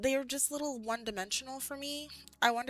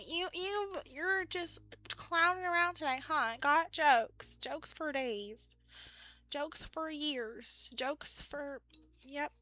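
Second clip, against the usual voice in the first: put-on light, teasing Southern country accent, higher; 5 semitones higher, much slower than usual. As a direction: tease Steve in a mild Southern drawl. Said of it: "I slipped into the country accent".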